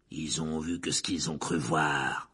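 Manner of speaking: low, slow ferocity; fierce. A narrator's reading. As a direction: dig in low and slowly.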